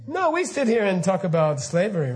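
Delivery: normal talking voice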